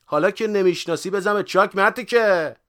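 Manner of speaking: high, full-voiced yelling; angry shout. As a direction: yell at him to clear off. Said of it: "داد کشید". (داد کشیدن با صدای بلند)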